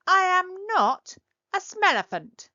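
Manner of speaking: a small creature outraged at the name; high indignant protest. Acted. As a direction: squeal back in outrage.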